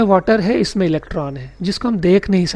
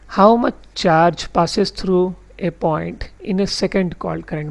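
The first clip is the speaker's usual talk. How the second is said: very slow, word by word definition read; slow dictation reading; much slower than usual. Shown as a demonstration. Teacher reads the textbook definition to his class slowly. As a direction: read the definition out slowly so students can note it.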